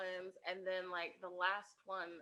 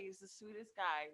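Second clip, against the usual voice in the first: usual speaking voice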